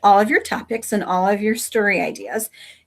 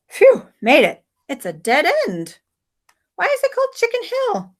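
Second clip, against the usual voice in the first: put-on high, bright-pitched young girl calling; girl's voice; 10 semitones higher than usual. Margot calls back to Samson, high girl's voice.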